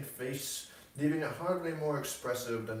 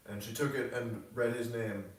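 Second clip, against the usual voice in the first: ordinary delivery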